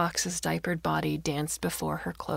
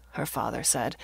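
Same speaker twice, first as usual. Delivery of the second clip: ordinary delivery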